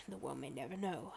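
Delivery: very soft, low, quick aside; murmured mock-mystery line; hushed resignation. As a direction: say it under your breath, low. Mock-solemn answer, then repeated slowly.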